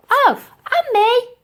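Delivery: put-on high, loud old-lady squeak. Dotty backing up the girl, high loud squeak. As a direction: chirp it in high and late.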